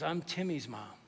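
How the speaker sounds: soft, low, retold tearfulness; hushed, understated sorrow; tearful mom. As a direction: gently imitate a mother close to tears. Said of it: "And she starts to cry".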